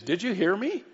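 put-on parent scolding kids. Parent to kids who were told to pick up, insistent questioning. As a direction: play the parent checking the kids listened. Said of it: "you say to the kids".

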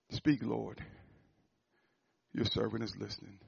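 quiet listening before God; hushed reverent prayer. Retold or imitated. Preacher showing a hushed prayer to God.

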